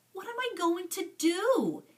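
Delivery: put-on frightened boy pleading with himself, high and desperate; miserable panic. A narrator's reading. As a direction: panic rising, voice climbing high. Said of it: "he asked himself miserably".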